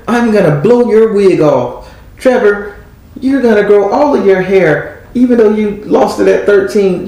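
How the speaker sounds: flirty high voice. Retold or imitated. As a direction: purr it like a playful seductress. Voicing Spirit's flirty promise.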